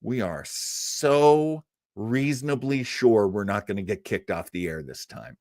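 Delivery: ordinary delivery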